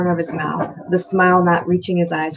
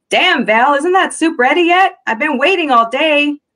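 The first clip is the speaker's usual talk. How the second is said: high, loud, impatient sister; whiny impatience at being kept waiting; 9 semitones higher, 5 dB louder than usual. A narrator's reading. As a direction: complain loudly, pitched way up. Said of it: "the impatient tone in Zoe's voice".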